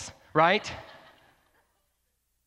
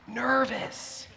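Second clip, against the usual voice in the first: nervous; 7 semitones higher, 5 dB quieter than usual. Retold or imitated. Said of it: "I was like".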